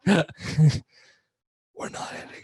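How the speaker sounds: slow breathy whispered giggle; laughing whisper. Real, not acted. Joking reversal of edit promise.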